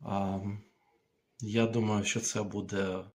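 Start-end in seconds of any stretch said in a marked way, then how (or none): none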